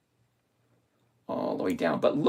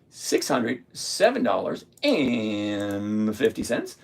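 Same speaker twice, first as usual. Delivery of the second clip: slow repeat; 6 semitones lower, slower than usual. Shown as a demonstration. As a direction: lower and slow, drawing the figure out. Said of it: "same figure said twice".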